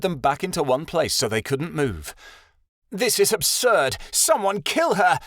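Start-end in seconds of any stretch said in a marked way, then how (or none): 2.9-5.2 high, furious outburst; angry shout. A narrator's reading. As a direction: shout an order in rage. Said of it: "Crying Ice shouted".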